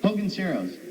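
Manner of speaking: normal talking voice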